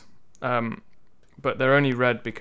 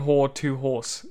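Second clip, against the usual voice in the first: normal talking voice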